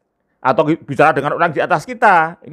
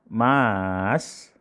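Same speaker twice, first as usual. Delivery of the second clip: mas said low and soft; wife's soft low mas; different intonation; 4 semitones lower, 7 dB quieter than usual. Shown as a demonstration. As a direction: murmur mas low and quiet. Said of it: "dari satu kata mas saja, diucapkan dengan intonasi yang berbeda".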